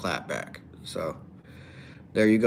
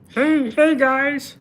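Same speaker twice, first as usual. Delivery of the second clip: very high, loud puppet frog voice; high-pitched frog greeting, belted out; Kermit; 8 dB louder, much slower than usual. Acted. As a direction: do a rough, squeaky frog impression. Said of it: "I guess that's a Kermit".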